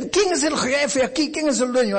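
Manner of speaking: high, sharp challenge; indignant demand. Acted. Driver demanding the clinic owner, high and indignant. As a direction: demand to see who's in charge.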